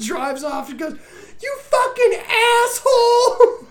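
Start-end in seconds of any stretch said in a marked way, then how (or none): 1.4-3.7 mock gleeful insult. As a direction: jeer while driving away. Driving off insulting her.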